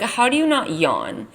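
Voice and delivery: ordinary talk, nothing marked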